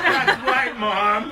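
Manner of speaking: mock agreement while cracking up; laughing. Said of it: "It's like".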